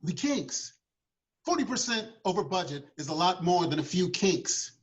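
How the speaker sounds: a touch of an incredulous money man; skeptical board member. Acted. Board grills the producer.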